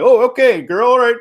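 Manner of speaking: high, loud mocking agreement; mock bros. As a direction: play the bros backing off. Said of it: "the bros should have been like".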